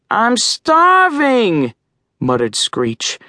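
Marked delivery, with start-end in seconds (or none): 0.1-1.7 high, slow, squawking little crow; reedy squawk. Acted. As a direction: squeak it out like a hungry young bird. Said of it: "His voice was a reedy squawk".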